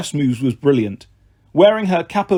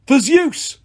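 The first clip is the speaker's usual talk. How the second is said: battle cry; 10 semitones higher than usual. Acted.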